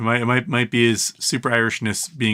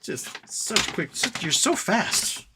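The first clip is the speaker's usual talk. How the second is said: doting pet talk; 6 semitones higher than usual. Real, not acted. Owner cooing to the dog, high baby talk. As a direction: coo over how fast the pup is.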